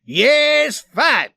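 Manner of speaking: high, loud battle roar. A narrator's reading. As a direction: roar your eagerness to fight. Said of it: "roared the Swede".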